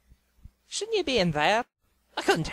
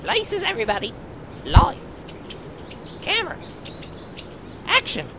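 director's call; 11 semitones higher than usual. Acted. Director starting the take.

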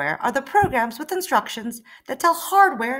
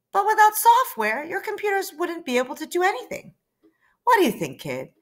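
much higher, quick reply voice; software character; 9 semitones higher than usual. Acted. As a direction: software setting the kid a question.

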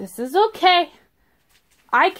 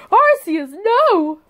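dismayed; 7 semitones higher, slower than usual. Real, not acted. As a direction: wail it out in disbelief. Duplicate card pulled.

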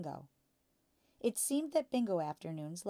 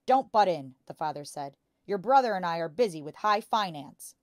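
irritated snap; 11 dB louder than usual. A narrator's reading. Father snaps at Matilda.

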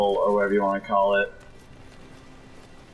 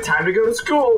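higher sing-song call to get up; mock wake-up call; 10 semitones higher than usual. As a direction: playfully yell the morning wake-up.

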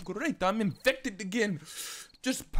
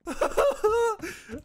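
high, loud burst of laughter; startled laugh; 5 dB louder than usual. Real, not acted. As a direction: laugh out in alarm. Horde appears as predicted.

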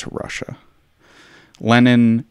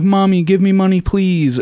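put-on whiny child; 10 semitones higher than usual. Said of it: "he would write to his mom and be like".

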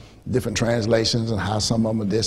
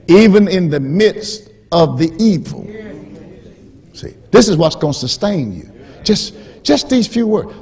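ordinary talk, nothing marked

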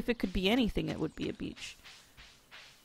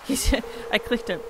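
ordinary talk

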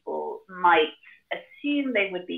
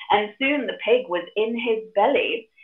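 ordinary delivery

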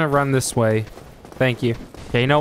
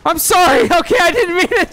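high, loud frantic apology; panicked apology; 6 dB louder than usual. Real, not acted. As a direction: yell sorry while fleeing. Chased by monster, panicking.

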